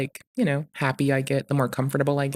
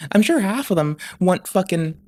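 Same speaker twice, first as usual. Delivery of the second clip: normal talking voice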